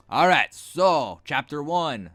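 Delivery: drawn-out, mock-grand chapter intro; put-on chapter announcement. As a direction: announce the chapter in a silly, emphatic voice. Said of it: "why I'm talking like this".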